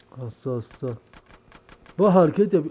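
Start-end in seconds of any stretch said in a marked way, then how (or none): 2.0-2.6 yelling at the game. Real, not acted.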